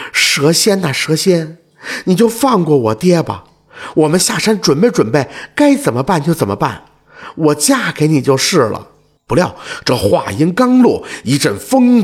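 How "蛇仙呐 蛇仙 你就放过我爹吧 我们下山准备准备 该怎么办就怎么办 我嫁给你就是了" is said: ordinary delivery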